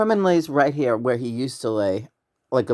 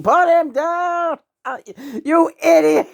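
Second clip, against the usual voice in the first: retold laughing scream; 5 dB louder than usual. Retelling yelling at Herman over Bunny, shrieking with laughter. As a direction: shriek it like you're cracking up. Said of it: "I was screaming".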